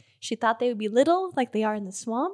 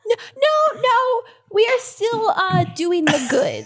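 much higher, earnest protest; high pleading Cordy; 8 semitones higher, 3 dB louder than usual. Acted. Cordy assures the threatening bee they're friends, high and earnest. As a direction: insist sweetly that all is fine.

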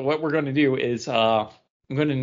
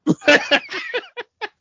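sudden high, loud laugh that breaks out; loud burst of laughter; 6 dB louder than usual. Real, not acted. Podcast host bursting out laughing at his cohost's lesion joke, loud and high. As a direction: crack up hard at the joke.

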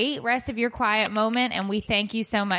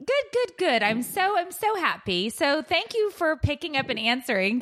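higher, chirpy mock British voice; mock British accent; 6 semitones higher than usual. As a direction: beam like a cheery British host. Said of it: "do a British accent".

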